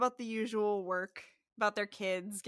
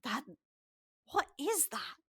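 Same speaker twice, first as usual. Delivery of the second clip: acted choking on a burning drink; boy spluttering after his first sip; coughing; 5 semitones higher, 12 dB louder, much slower than usual. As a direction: cough loudly, then splutter the question higher. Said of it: "starts coughing".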